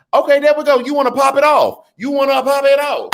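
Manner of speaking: high, loud hype cheer. Real, not acted. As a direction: hype the sticker up. Hyping a super sticker.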